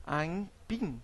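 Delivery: aimpim with a nasal m inserted, aim-pim; nasalised. Shown as a demonstration. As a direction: say aimpim, not aipim. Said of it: "Por exemplo".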